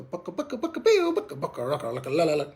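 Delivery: mix bucks and yodel notes; bucka with two notes. Shown as a demonstration. Instructor showing advanced blended goose calls, rolling notes. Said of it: "Throw two notes in it".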